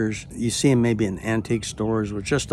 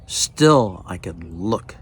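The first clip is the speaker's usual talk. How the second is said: normal talking voice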